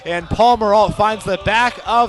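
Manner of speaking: loud, rising roar of a goal call; excited goal call. Real, not acted. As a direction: let it rip as the ball hits the net. Goal call in soccer commentary.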